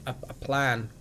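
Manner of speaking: usual speaking voice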